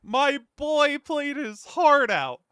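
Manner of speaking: loud, high, slow indignant exclamation; fired-up outburst. Retold or imitated. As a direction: belt it out like defending a friend. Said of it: "and I'm like".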